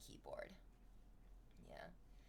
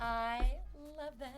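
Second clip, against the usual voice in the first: drawn-out gush; 4 semitones higher, 5 dB louder, slower than usual. Real, not acted. Repeats slowly, drawn out.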